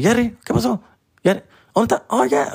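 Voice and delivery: high-pitched mock mom calling for Jerry; friend's mom calling out. Comic calling Jerry in a high mock mom voice. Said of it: "She would be like".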